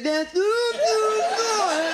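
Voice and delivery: high strained tongue-out whine. Acted. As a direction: say it with the tongue stuck out. Irishman with tongue out.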